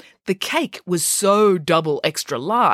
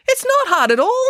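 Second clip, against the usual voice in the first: high, louder and breezy; breezy pig; 5 dB louder than usual. A narrator's reading. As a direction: toss it off lightly. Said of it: "said Arthur".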